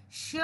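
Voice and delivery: श said alone as a clear model sound; proper pronunciation. Shown as a demonstration. Teacher asks children to pronounce properly, श said carefully. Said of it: "pronounce properly dear children".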